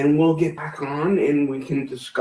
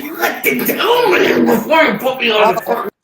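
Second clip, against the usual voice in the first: angry raised voice; 5 semitones higher, 6 dB louder than usual. Real, not acted. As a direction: yell it at her, fed up. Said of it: "He's fucking mad".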